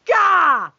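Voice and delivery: loud frustrated gah; exasperated shout. Real, not acted. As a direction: blurt out in frustration. Exasperated outburst.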